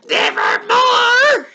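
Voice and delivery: slow, very high, shouted; booming raven cry. Acted. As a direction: let the raven thunder its answer.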